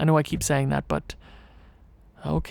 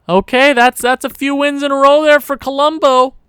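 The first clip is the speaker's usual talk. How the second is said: loud, raised-pitch cheer; excited celebration; 8 semitones higher, 10 dB louder than usual. Real, not acted. Gamer celebrating a run of wins, excited celebration. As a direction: call it out with rising excitement.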